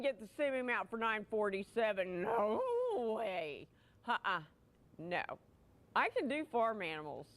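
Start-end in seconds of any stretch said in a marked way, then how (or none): none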